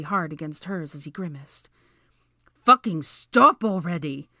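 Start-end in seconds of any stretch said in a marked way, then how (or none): 2.6-4.3 loud, drawn-out plea; desperate yell. A narrator's reading. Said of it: "Draco yelled desperately".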